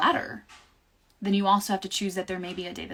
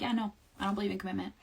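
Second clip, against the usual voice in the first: fast dismissive; 6 dB quieter, much faster than usual. Retold or imitated. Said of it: "being like".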